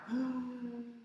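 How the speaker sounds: sharp inhaled gasp; deep gasp for breath. Acted. Chang gasping before speaking to mother. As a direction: suck in a big breath. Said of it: "took a deep breath".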